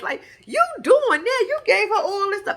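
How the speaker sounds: high, excited retold cheering; hyping a friend. Hyping up friend while patting back.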